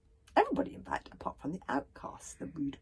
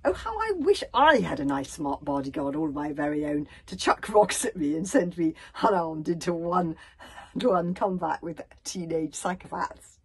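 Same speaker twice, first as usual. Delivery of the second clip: sarcastic; 9 dB louder than usual. A narrator's reading. Fishlegs moans about the bodyguard chucking rocks, sarcastic. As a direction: gush loudly with heavy sarcasm.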